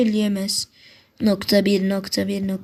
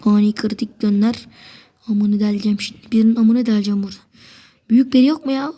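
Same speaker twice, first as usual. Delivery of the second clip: ordinary delivery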